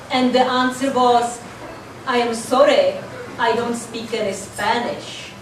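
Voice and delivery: ordinary talk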